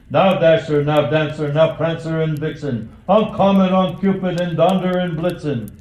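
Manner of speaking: a jolly driver's hearty shout; shouting to reindeer. A narrator's reading. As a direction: call each reindeer by name across the roof. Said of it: "he whistled and he shouted and he called them by name".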